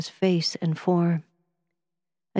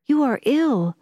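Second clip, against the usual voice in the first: exclaimed; 7 semitones higher than usual. A narrator's reading. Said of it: "I exclaimed".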